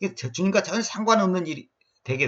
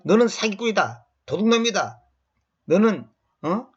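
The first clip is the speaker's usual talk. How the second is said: mock name-calling; 6 semitones higher than usual. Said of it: "이렇게 말해도 무서운 욕인데".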